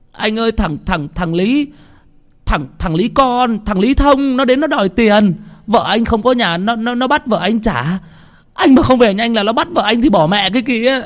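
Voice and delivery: high-pitched, breathless panicked stammer. A narrator's reading. Tý warns Tửng about the debt collector, breathless. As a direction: gasp out the bad news, tripping on words. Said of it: "Thằng Tý nói không ra hơi".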